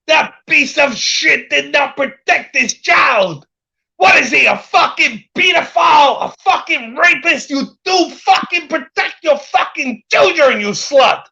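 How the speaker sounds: indignant impersonated tirade; mock rant. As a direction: quote his furious accusations at full tilt. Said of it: "You're fucking ranting about people".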